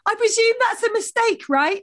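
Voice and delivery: high, sugary, pointed question; fake-nice, laughing through it; sweetly passive aggressive. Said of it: "she's just like".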